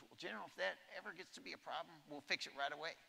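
high, quick reassuring president voice; imitating the president. President promises the general a quick fix, high and quick. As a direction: toss off the promise like a decisive boss. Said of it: "And he goes".

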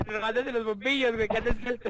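talking through laughter; teasing between laughs; laughing. Real, not acted. (hablando entre risas)

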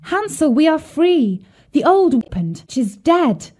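high, excited cry of relief; joyful cry. A narrator's reading. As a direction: cry out the good news. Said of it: "and cried".

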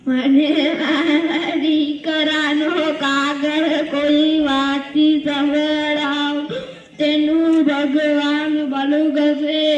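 slow, drawn-out acted lament; tearful wailing. Old mother begging someone to read her son's letter, tearful wail. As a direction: wail it slowly, stretching the words. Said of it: "શા માટે રડો છો?".

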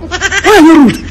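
high, drawn-out indignant question. Acted. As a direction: stretch it out high, offended. Offended man challenges rude woman.

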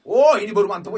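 loud, high delighted shout; excited cheer. Real, not acted. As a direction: cheer at the win. Good spin result. (sorak gembira)

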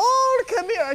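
Martha Stewart impression. Guest imitates Martha Stewart greeting the snake, in a high, hammed-up coo. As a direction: gush at the animal like a doting hostess. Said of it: "I'm so bad with impersonations".

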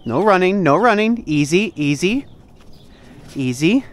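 loud, high call to a dog; firm command. Real, not acted. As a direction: call out to stop the dog running. Telling the dog to calm.

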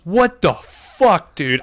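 high, slow, loud stunned shout; shocked Buzz. Retold or imitated. Buzz stunned at his own chopping arm, high loud disbelief.

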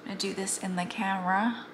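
usual speaking voice

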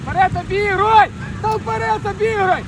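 loud urging coach shout; yelling from the sidelines. Real, not acted. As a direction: push the player forward from the touchline. Coach urging player to attack.

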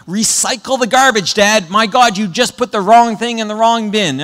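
put-on an eight-year-old lecturing dad; nagging rule-enforcer kid voice; bossy kid. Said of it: "They love to tell everybody".

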